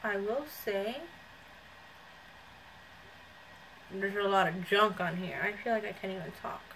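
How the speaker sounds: speaking with face covered; through a sheet mask. Real, not acted. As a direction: mask over mouth area. Seen 0: Sheet mask covers her face, mouth area, while she talks.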